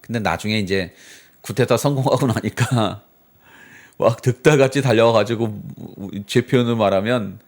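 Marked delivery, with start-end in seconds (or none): none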